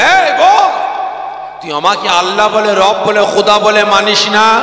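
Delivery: high, loud barking demand from the tyrant; scolding Pharaoh. Acted. Pharaoh rebuking the maid Masita, a furious high shout. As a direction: bellow it down at her like an angry king. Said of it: "ধমক দিয়ে বলে".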